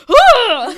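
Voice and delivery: huge, high comic "ah!"; exaggerated shocked yell. Impersonating a friend's reaction to the photo, exaggerated yell. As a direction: burst out in a hammed-up shout of shock. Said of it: "it's very exaggerated".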